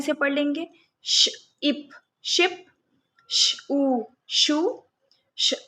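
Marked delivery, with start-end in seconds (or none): 1.0-4.8 slow phonics blend, sh then ip then ship; sound-by-sound blending. Shown as a demonstration. Teacher to kids, slowly blending sh-words sound by sound. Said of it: "ये श sound बच्चों को आ जाएगा".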